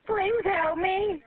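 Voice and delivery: very slow, drawn-out begging; real despairing plea, each word dragged; desperate plea. Real, not acted. Drowning caller begs dispatcher, desperate plea.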